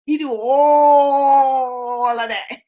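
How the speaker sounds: ALL stretched very long on a held note; exaggerated mock praise. Mocking praise of the anointed man, drawn out. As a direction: drag out "all" in mock awe.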